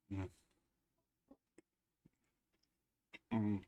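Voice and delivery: eating while talking; mouth full. Real, not acted. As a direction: talk through a mouthful of chocolate. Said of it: "I don't like talking with my mouth full".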